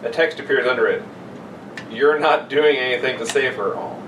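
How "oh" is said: ordinary talk, nothing marked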